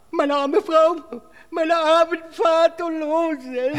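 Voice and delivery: high, slow overdone wailing; whining lament. Acted. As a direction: whimper about your poor family. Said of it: "Hör endlich mit dem Gejammer auf". (hohes, langsames Gejammer)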